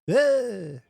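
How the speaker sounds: loud nasal Dylan "Ah"; [name] groan. Retold or imitated. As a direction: belt a Dylan-style "Ah". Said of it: "[name], the proctologist".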